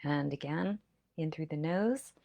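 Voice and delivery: calm breathing instructions; guided breathing. Acted. Guides deep breaths together.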